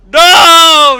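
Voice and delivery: very loud high cry of No; shocked scream. Acted. Scootaloo and Aurora seeing Orion attacked, horrified scream. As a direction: scream in horror as he's grabbed.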